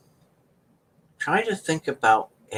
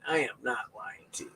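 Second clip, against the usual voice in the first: hushed whisper, much softer; 2 semitones lower, 12 dB quieter than usual. Real, not acted. Assuring viewers the swollen pinky story is true, whispered. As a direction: lean in and whisper it.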